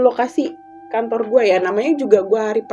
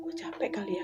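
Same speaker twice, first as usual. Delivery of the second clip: tired thought; 2 semitones lower, 16 dB quieter than usual. Retold or imitated. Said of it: "gue pikir gitu kan".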